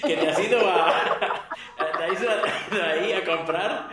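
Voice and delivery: breaking into laughter mid-question; laughing mock disbelief. Retelling neighbours' shock at a shopping trip, laughing mock disbelief. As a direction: struggle through the question, laughing.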